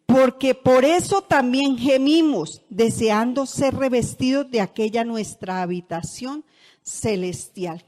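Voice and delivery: loud, slow, declaiming Bible verse; solemn loud Bible reading; scripture reading. Acted. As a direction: proclaim it to the congregation. Reads scripture aloud.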